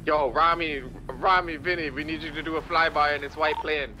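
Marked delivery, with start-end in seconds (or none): none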